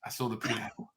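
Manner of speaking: very soft, low, casual imitation; hushed, nonchalant impression of the husband; mock cool. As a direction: toss off the confession as if it were nothing. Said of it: "so, so coolly".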